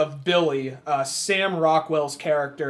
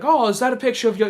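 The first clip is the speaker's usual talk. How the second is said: quick, higher prying question; mock berating; 6 semitones higher, 3 dB louder, faster than usual. Kid badgering the grieving couple, retold needling. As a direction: imitate a pushy nosy kid, pitched up. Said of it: "he just keeps on berating".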